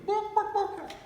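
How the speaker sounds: high, loud, squawked chicken bark; squawking chicken. Acted. Chicken in the joke speaks.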